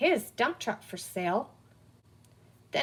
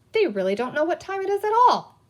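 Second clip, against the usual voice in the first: amazed; 7 semitones higher, 5 dB louder than usual. A narrator's reading. Peter's amazed thought.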